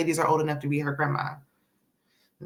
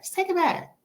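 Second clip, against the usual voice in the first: Just take it back said much higher in a put-on voice; high-pitched repeat; 13 semitones higher than usual. Shown as a demonstration.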